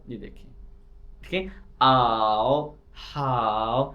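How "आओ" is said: ordinary talk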